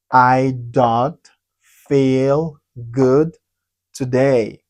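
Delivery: "I don't feel good today" slow, with pauses between words; slow word-by-word model. Shown as a demonstration. Teacher modelling a new sentence, slow and separated.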